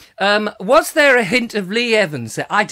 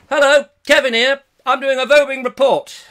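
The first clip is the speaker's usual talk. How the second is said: high, loud mock reporter greeting; chirpy intruding reporter; 7 semitones higher, 6 dB louder than usual. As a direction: burst in with a loud announcement. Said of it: "just when you think it's safe to go out".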